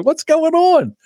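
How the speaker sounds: very high, alarmed demand; mock the girlfriend calling out; imitated girlfriend. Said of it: "She comes out there".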